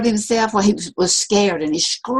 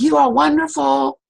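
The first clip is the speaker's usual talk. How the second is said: high, loud call with stress on are; 7 semitones higher, 4 dB louder than usual. Retold or imitated. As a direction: proclaim it to the canyon. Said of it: "And he said".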